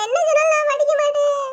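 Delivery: high wailing defiance; crying refusal. Acted. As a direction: cry out the refusal, pitched high. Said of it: "அழாத, அழாத". (அழுகையோடு பிடிவாத மறுப்பு)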